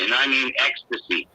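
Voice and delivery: ordinary talk, nothing marked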